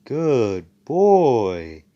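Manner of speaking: condescending praise. Retold or imitated. Asta to the beaten thief, mock-praising like a dog. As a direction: talk down like to a dog. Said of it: "And Asta's like".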